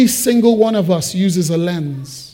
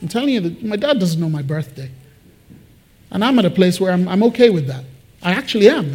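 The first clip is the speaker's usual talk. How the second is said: normal talking voice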